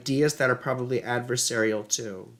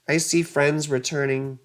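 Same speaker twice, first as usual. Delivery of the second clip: ordinary delivery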